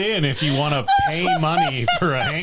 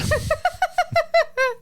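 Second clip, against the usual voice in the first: high villain cackle; evil cackle. Pretend. Villain laugh continues.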